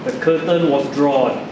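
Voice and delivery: plain reading voice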